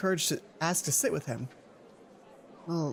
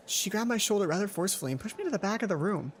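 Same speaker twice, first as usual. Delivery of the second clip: plain reading voice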